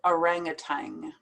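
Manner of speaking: "orangutan" ending in "tang", with NG; American tang ending. Shown as a demonstration. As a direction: finish "orangutan" on an NG sound. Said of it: "I normally pronounce it".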